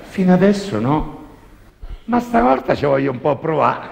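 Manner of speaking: put-on high, loud cheeky girl. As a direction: squeal it like a mischievous girl. Girl answers the priest.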